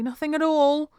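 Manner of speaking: high, loud, overdone innocence; unconvincing denial. A narrator's reading. Daughter covering up the banging to mum, unconvincingly. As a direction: insist far too eagerly. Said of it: "I reply unconvincingly".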